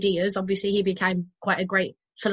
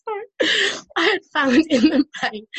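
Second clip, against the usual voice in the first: higher, louder, laughter bubbling under the reading; real suppressed giggles; 7 semitones higher, 4 dB louder than usual. Real, not acted. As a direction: try to read on through giggles. Giggling at the quote she reads.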